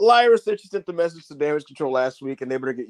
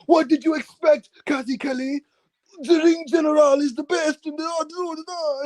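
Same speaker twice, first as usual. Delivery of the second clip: mock Kaiser promo; 10 semitones higher than usual. Host imitating Ludwig Kaiser boasting at Kathy Kelly, high and shouted. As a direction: brag like an arrogant heel. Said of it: "he was just kinda like".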